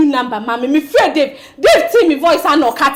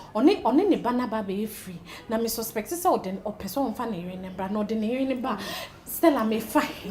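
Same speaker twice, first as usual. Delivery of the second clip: dejected murmur; 5 semitones lower, 13 dB quieter than usual. Acted. Despondent quiet confession.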